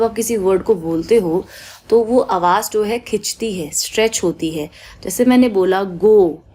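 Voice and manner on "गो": गो with the ओ held long; stretched long o. Shown as a demonstration. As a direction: draw out गो slowly. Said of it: "ओ stretch हो रहा है". (गो में ओ खींचकर)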